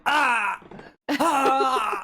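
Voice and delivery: high, loud hammed-up yelps of pain; mock pain yelp. Imitating Lily hurt by a falling box, hammed-up yelps. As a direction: yelp like the box just hit your ankle. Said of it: "and she's like".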